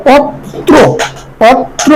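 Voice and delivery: পত্র slowly as "pot-tro"; doubled t. Shown as a demonstration. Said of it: "দুইটা উচ্চারণ হওয়ার". (পত্র ভেঙে "পত্-ত্রো")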